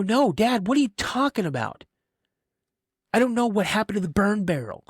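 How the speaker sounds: put-on high, baffled kid protesting to dad; retold protest. Protests innocence to his dad.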